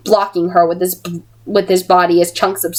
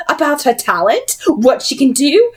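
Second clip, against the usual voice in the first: prying villainess; 8 semitones higher than usual. Acted. Villain presses Will.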